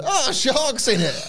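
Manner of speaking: very high, slow startled gasp; startled wrestler. Acted. Wrestler spotting a shark in the twister, high slow squeak. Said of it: "one of them looks over".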